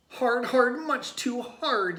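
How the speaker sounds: high, loud yell, slowing at the end. A narrator's reading. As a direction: shout it, squashed. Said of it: "yelled Tate".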